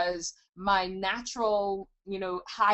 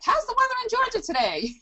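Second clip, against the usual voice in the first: much higher, fast gushing sales greeting; overly friendly salesperson; 3 dB louder, faster than usual. Retold or imitated. Mocking a cold caller phoning a stranger, hammed-up and much higher. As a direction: ham up a pushy cold caller's fake cheer. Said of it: "They're like".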